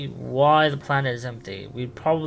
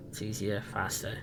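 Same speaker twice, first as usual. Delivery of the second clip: much softer, half-whispered; quiet aside; 4 semitones lower, 10 dB quieter than usual. Real, not acted. Streamer explains to chat why he will speak, hushed aside. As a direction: mumble it off to the side. Aside about talking instead of typing.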